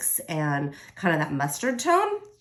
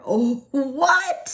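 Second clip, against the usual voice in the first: surprised; 6 semitones higher, 5 dB louder than usual. Real, not acted. Sticker goes on straight.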